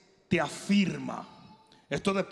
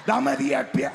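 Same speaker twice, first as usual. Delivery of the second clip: mock order shout; 5 semitones lower, 6 dB louder than usual. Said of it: "y yo dije".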